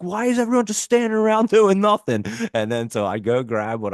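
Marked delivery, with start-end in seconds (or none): 0.0-2.2 high, louder mock complaint; high-pitched loud mock yell; angry customer. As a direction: imitate him yelling at idle staff. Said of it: "He's like".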